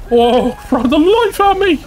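high-pitched, amazed yelp; excited outburst. Real, not acted. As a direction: react in real astonishment. Startled by events in game.

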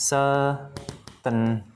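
Certainly stretched very slowly, each sound clear; drawn-out certainly. Shown as a demonstration. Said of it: "phiên âm sao các bạn".

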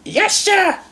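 very high-pitched happy reply; high, gleeful character voice; cheering little engine. A narrator's reading. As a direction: cheer it as a tiny keen engine. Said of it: "cheered Stanley".

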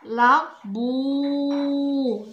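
لا بو stretched very slowly; over enunciating. Shown as a demonstration. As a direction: draw out لا بو sound by sound. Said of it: "عندنا هنا".

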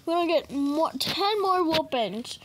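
ordinary talk

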